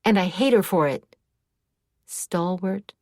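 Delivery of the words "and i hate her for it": angry. A narrator's reading. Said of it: "erupted into a squall of emotion".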